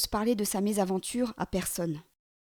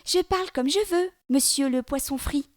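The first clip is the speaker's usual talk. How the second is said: high, louder teasing voice; boy sassing the okapi-headed monster, high and cheeky; cheeky taunt; 7 semitones higher, 4 dB louder than usual. Acted. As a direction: taunt him like a brat.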